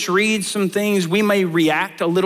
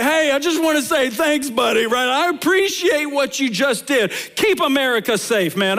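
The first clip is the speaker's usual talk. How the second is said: cheery thanks; 5 semitones higher than usual. Retold or imitated. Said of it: "I'm like".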